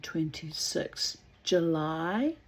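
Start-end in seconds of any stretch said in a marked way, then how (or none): none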